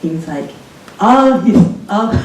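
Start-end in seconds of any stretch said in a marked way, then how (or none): 1.0-2.1 attempted German accent, higher and louder; a shaky stab at a German accent. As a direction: try a German accent and half miss it. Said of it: "I can't do the German accent".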